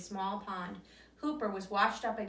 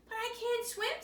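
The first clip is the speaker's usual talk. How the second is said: high, fretful little-bunny voice; little hare pleading he can't swim; worried little bunny; 13 semitones higher than usual. Acted. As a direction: squeak it out as the timid hare. Hooper afraid of river.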